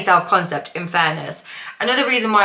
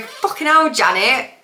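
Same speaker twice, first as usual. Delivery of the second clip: put-on Midlands accent; 5 semitones higher than usual. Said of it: "sat there going".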